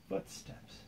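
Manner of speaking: breathy hushed footsteps; spooky whisper. A narrator's reading. Storyteller whispering to child about footsteps, hushed. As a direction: hush it like a secret.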